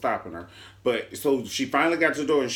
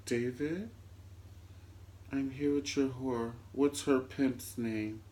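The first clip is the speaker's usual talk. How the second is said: low, much softer creepy voice; talking low; 9 dB quieter, slower than usual. Retold or imitated. Veronica at Erica's door asking about her husband, quiet menace. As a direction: hush it like a menacing stalker. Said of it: "talking all low and shit".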